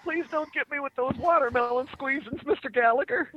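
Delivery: high-pitched pleading little voice; hammed-up high plea; mock plea. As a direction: beg in a squeaky mock-timid voice. Plays a frightened fan of Gallagher.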